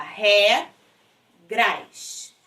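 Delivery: "Ré, gras" in two separate beats; syllable by syllable. Shown as a demonstration. Teacher separating the syllables, syllable by syllable. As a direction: leave a gap between "Ré" and "gras". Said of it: "Separando sílaba".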